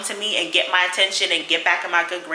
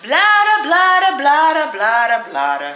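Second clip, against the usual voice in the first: mock sing-song blubbering; 8 semitones higher, 4 dB louder than usual. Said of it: "boo-hoo bawling".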